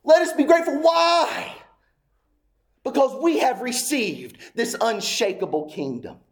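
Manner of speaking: high, emphatic pulpit exhortation; full-force preaching. Real, not acted.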